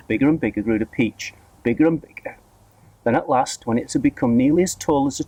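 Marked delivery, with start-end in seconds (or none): none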